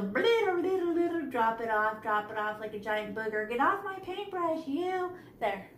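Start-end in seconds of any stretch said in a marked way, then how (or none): 0.0-1.3 higher sing-song blitter, blitter; playful sound word. Shown as a demonstration. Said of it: "So I just go". 3.5-5.1 higher, playful telling-off; mock scolding. Scolding paint blob.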